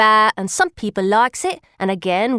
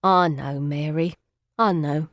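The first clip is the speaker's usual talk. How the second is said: tender comfort; 7 semitones lower, 3 dB quieter than usual. Acted. Charlie consoling weeping Mary.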